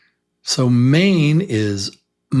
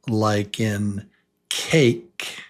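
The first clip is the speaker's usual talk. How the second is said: very slow, drawn-out example; slow teaching model; slow model word; 2 semitones lower, much slower than usual. Shown as a demonstration. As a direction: stretch it out for learners to copy. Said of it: "say them with me".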